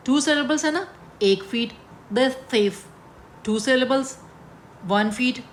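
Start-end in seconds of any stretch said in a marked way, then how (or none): 2.1-2.7 "the thief" as two separate beats; one foot, syllable-split. Shown as a demonstration. Teacher demonstrating a two-syllable foot, "the thief" beat by beat. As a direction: sound out "the", then "thief", deliberately. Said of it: "देखो मैं अगर ऐसे पढ़ूँ".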